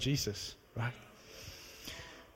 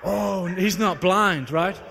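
stunned realization; 4 semitones higher, 7 dB louder, much slower than usual. Retold or imitated. Retold sudden realization.

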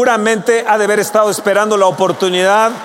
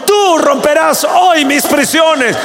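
much higher, louder shouted sermon; fiery preaching; 8 semitones higher, 4 dB louder than usual. Acted. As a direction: call the congregation out at full force. Preaching at full force. (predicación a gritos, mucho más aguda y fuerte)

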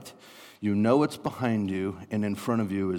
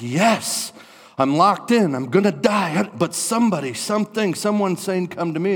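high, loud, urgent outburst; acted breathless-with-hope exclaiming; excited relief; 8 semitones higher, 7 dB louder than usual. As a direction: cry out at sudden hope of rescue. Acting trapped person hearing rescue.

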